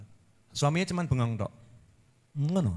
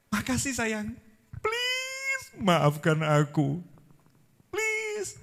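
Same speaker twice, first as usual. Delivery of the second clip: pleading husband; 6 semitones higher, 5 dB quieter, much slower than usual. Acted. Husband pleading sweetly to wife.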